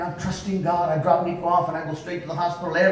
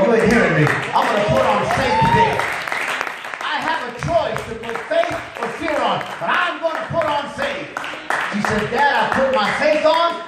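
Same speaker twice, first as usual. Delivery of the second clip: loud, lifted testimony preaching; full-force preaching; 5 semitones higher, 3 dB louder than usual. Acted. Preacher retelling daughter's choice of faith over fear to congregation, full-force preaching. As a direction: preach it with rising fervour, driving the key words.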